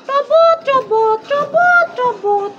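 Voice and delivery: slow, high chugging car sound; car noise. Pretend. Mother making the toy car's driving noise, slow and high.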